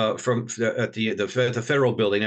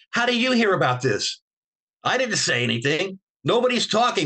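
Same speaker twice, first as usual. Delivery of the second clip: baffled disbelief; 6 semitones higher than usual. Retold or imitated. Baffled at leak of secret.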